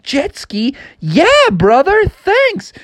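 very high, delighted character voice; excited acceptance. A narrator's reading. Frog offered a jet ski by a stranger, high and excited. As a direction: burst out with growing eagerness.

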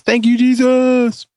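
high, slow praising exclamation; mock praise. Shown as a demonstration. Host parodying a praise phrase, high and slow. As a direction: lift it up like a church thank you. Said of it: "instead of".

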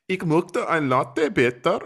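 put-on "Ich möchte ein Latte bitte" slow and high in a thick cheesy German accent. Retelling ordering at a German café, hammed-up German accent. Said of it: "kind of a cheesy German accent".

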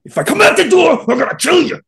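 loud, high retold yell; very angry threat. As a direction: re-enact blowing up at a friend. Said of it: "I got very angry and I said".

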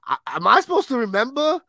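high, slow exasperated rant; pleading in disbelief. Real, not acted. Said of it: "I gotta tell you".

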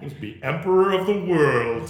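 mock grandeur. Host proclaiming himself emperor, slow and grand.

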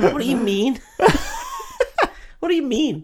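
high, laughing put-on voice; baffled yelp through laughter; mock bewildered townsperson. Townsfolk baffled by the shooting, high and laughing.

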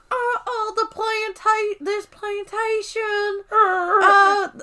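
high, slow, overdone hillbilly drawl; badly faked Southern accent, trying too hard. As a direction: imitate a struggling high-voiced hillbilly. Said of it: "he sounds like a hillbilly".

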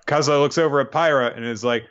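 normal talking voice

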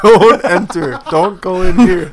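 loud mock warning. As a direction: bark it out like a joke sign. Mock sign on the shed.

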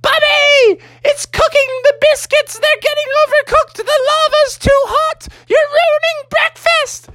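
very high falsetto scolding; scolding Mother Bear. Acted. Mother Bear upset about ruined biscuits, high scolding falsetto. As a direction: fuss shrilly in a mama-bear falsetto.

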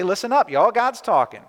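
put-on a loud call in Southern accent. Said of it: "as our southern friends would say".

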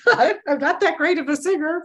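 giggling, high and quick; laughing. Real, not acted. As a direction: laugh it out nervously. Laughs before singing.

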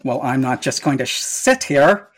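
ordinary talk, nothing marked

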